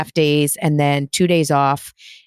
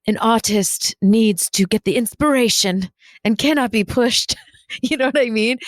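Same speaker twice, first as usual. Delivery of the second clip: higher, grand mock artiste; lofty artist; 5 semitones higher than usual. Podcaster mocking herself as a precious artist. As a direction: play the precious artist who can't be rushed.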